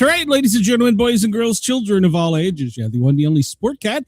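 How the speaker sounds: booming ringmaster-style host intro; grand entrance announcement. Acted. Host opens the radio show.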